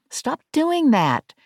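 slow, high, pointed complaint; irritated. A narrator's reading. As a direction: stop it, annoyed and drawn out. Verity snaps at AI.

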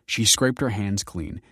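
plain reading voice

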